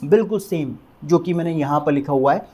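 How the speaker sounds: ordinary delivery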